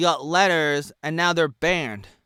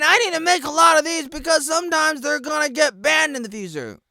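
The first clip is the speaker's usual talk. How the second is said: shouting much higher and louder; furious rant; 11 semitones higher, 9 dB louder than usual. Real, not acted. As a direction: hammer every complaint in rage. Furious rant about YouTube strikes.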